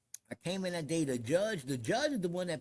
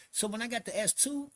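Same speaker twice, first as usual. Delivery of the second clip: ordinary talk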